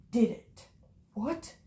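normal talking voice

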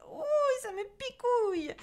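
very high, loud squeal; squealing, suffering boy; pleading. A narrator's reading. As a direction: beg in a high whine as if being pricked. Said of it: "supplia-t-il en transpirant".

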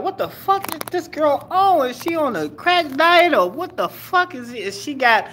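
high, put-on voice of disbelief; hammed-up shocked inner voice; mock incredulous. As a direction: squeal the question in mock shock. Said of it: "I'm like".

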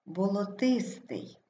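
болотистий slowly, stressed боло-ТИС-тий; stress on third syllable. Shown as a demonstration. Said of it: "Наголос падає на третій склад". (болотистий повільно, з наголосом на ТИС)

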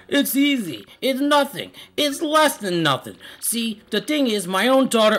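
high Italian mob boss; Italian accent. Acted. Don Pianta describing the favor, high Italian-accented voice. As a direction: explain it like a cartoon Italian don. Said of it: "I speak it in an Italian accent".

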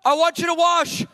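high, loud booming divine call; hammed-up loud heavenly command; voice of God. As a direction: proclaim it as God speaking. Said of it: "he says".

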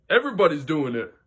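usual speaking voice

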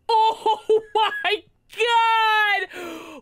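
high, loud, drawn-out laughing cry; thrilled, stretched laughing yell; laughing shout. Real, not acted. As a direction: burst out laughing as you shout. Thrilled by the speed boost.

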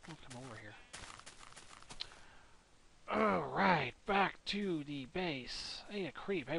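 ordinary talk

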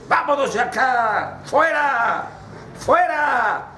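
high cry of banishment; acted high-pitched expulsion; guard's dismissal. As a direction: call out a sharp order to leave. Guard orders prince out.

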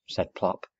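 plain reading voice

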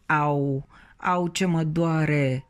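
in pain.

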